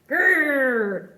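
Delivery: tiger growl. Acted. Small tiger growls to prove himself, mock growl. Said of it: "A tiger hunts for its lunch".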